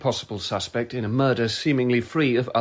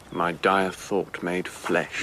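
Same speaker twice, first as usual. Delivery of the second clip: very slow, low, dark aside; gloomy pronouncement; 7 semitones lower, much slower than usual. Acted. Suspect greets the constable's arrival, slow doom-laden gloom. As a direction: intone it low and drawn out.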